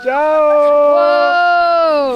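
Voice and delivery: loud excited whoa; sledding downhill. Real, not acted. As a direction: whoop on the way down. Sledding down the volcano.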